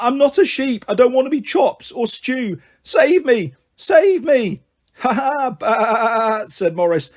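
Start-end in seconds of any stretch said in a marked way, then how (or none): 0.1-4.6 high, desperate begging; high-pitched frantic cry; screamed plea. A narrator's reading. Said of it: "He looked up and screamed". 5.0-6.4 long, drawn-out imitation sheep baa; laugh, then bleat slowly like a sheep; teasing stretched-out baa; laugh and mock bleat. A narrator's reading. Boy mocks the bleating sheep.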